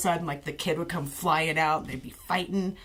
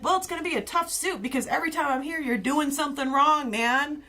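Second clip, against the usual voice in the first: high, quick smug comeback; imitating a smug cop; 8 semitones higher than usual. Officer answering a harassment threat, high and fast. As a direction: rattle it off, pleased with himself. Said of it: "And he's like".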